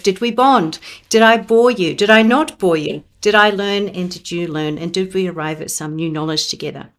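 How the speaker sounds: normal talking voice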